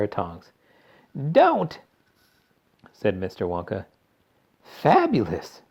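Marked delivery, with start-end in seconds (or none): 1.3-1.8 high, loud cry of alarm; alarmed warning. A narrator's reading. Wonka tries to stop Violet. 4.6-5.6 drawn-out, higher exclamation; delighted shout. A narrator's reading. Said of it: "shouted Violet".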